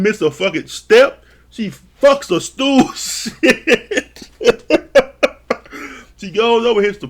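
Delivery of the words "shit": high laughing exclamation. Real, not acted. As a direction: laugh through it.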